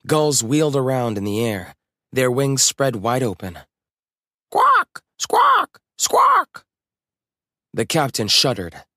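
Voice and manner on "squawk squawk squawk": very high, loud gull squawks. A narrator's reading. Gulls wheeling over the ship, piercing squawks. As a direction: screech like a seagull, each cry falling.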